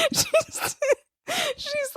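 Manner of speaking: very high, squeaky and overcome; helpless laughing. Real, not acted. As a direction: try to speak through a laughing fit. Teller breaks down retelling story.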